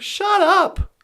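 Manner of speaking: normal talking voice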